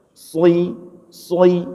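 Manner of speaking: "Sui" with a rounded, heavy shad; shad sound. Shown as a demonstration. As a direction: say "sui", not "shi". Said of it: "jangan shi, sui". ("sui" dengan shad tebal, bukan "shi")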